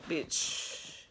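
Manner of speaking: breathy whisper to herself; whispered aside. Real, not acted. Vlogger muttering to herself, whispered. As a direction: whisper it under your breath.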